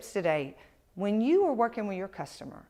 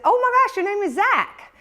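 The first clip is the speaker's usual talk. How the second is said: high, loud delighted gush; light up like you've met family; excited; 11 semitones higher, 9 dB louder than usual. Retold or imitated. Greeting Zach on the phone, bursting with delight.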